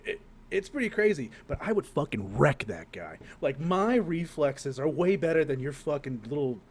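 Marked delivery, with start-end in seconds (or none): none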